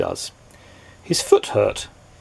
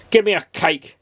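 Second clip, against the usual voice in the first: higher, curt demand; snapped; 7 semitones higher than usual. A narrator's reading. Grumpy customer snapping at the cake seller, curt and higher. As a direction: snap the order at the baker. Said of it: "snapped Mister Grumpy".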